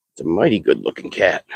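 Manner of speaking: normal talking voice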